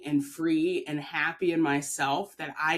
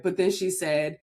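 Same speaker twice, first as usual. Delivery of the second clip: normal talking voice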